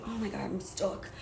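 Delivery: normal talking voice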